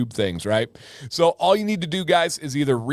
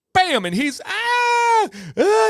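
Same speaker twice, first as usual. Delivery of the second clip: high mock scream, louder; imitated scream; 4 dB louder, slower than usual. Retelling his stung buddy, high mock scream. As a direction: yelp like a stung kid.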